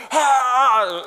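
loud, strained roar that gives up; a big rock-star yell cut short; loud mock cry. Guitarist yelling before setting the guitar down, loud mock cry.

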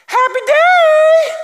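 squealed Southern "Happy day!", very high and loud; Southern accent. Retold or imitated. Girl greeting staff at dawn, high Southern squeal. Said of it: "she had a Southern accent".

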